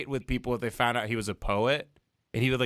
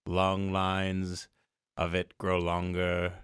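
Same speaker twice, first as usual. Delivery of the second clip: poem recitation; 8 semitones lower, much slower than usual. Retold or imitated. Friend quoting a favourite Stevens line, slow and low. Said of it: "when he says".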